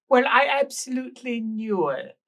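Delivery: high, loud, slow and theatrical; shrill tipsy woman. Acted. Rouged woman on the stairs gushing at the guests, high and loud. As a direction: gush it like a tipsy lady of the house. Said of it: "and says".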